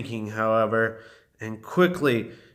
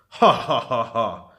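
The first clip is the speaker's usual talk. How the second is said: scornful stagey "ha ha ha ha"; dragon laugh; 5 semitones lower than usual. A narrator's reading. Dragon mocking the small witch, hammed-up laugh. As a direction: laugh like a dragon, falling in pitch. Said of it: "that made the dragon laugh".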